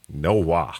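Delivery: lightly put-on Florida country drawl on "wa"; a touch of rural Florida in the vowel; Florida country accent. Host borrows a Florida saying to refuse, light country accent. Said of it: "that little accent comes from".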